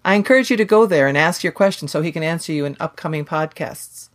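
ordinary delivery

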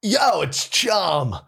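big high, stretched-out cartoon voice; goofy dumb fish. Pitching Chum the fish to Nickelodeon, goofy dumb voice. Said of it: "He's kind of this goofy, dumb chum".

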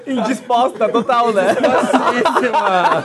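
slow words through real laughter; laughing while talking slowly. Real, not acted. Laughing at the failed prank call.

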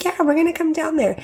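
high, quick airy promise; mock breezy neighbor. Mimicking the neighbor's hollow promise, high and quick. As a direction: toss it off lightly, insincere. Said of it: "she was like".

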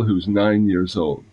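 ordinary delivery

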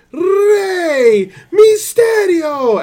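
high, long drawn-out announcer shout; ring announcer call. Retold or imitated. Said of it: "pronounce it with the accent".

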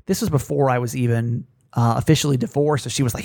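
usual speaking voice

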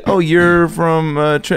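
very slow, leading tone; knowing reaction. Retold or imitated. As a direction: imitate someone slowly catching on. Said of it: "You could tell the way she said".

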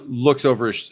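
normal talking voice

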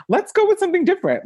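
high, fast, eager pitch; bossy designer. Pretend. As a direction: pitch a new idea to God. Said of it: "I would have been like".

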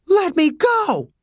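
high, drawn-out desperate shout; captive's high-pitched plea; desperate cry. Acted. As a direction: cry out slowly, begging to be released. Captive girl screaming at her captors.

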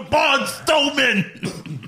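very high, very slow mock yell; echoing the yell. Co-host echoes the Lashley yell, very high and slow. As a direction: stretch the name out laughing. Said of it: "the one where he was yelling at Braun Strowman".

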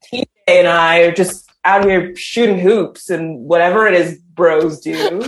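lightly voiced American bro; a hint of a laid-back American dude; American bro accent. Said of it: "I'm American".

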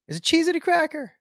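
high-pitched wondering question; high, squeaky puzzlement; puzzled questioning. Real, not acted. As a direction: jump up high as if suddenly doubting it. Puzzles over his own claim.

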